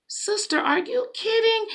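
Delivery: aghast, can't believe your eyes; mock shocked sister at a mermaid dress; incredulous. Retold disbelief at a dress.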